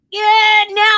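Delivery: high, loud yelp of mock nerve; balking at jumping. Retelling a cliff jump to a friend, mock panicked balking. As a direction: psych yourself up loudly, then chicken out. Acting out last-second refusals to jump.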